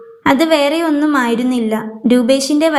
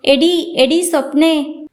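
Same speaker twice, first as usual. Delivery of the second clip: plain reading voice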